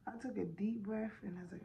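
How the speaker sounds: ordinary talk, nothing marked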